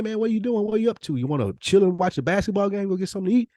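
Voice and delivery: usual speaking voice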